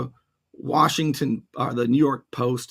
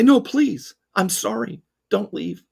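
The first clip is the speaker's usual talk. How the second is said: mock pleading; 7 semitones higher, 5 dB louder, slower than usual. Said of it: "No, I don't say that".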